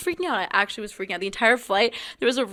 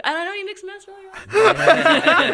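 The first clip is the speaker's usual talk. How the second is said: higher, louder, laughing mockery of her line; teasing laugh; 10 semitones higher, 8 dB louder than usual. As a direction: tease her by parroting it through laughter. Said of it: "they're like".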